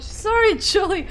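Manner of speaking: high laughing apology. Real, not acted. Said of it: "I gave you the worst voice ever".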